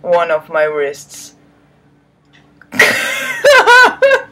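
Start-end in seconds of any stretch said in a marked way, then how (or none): 2.7-4.3 laughing. Real, not acted. Reader laughs at the text.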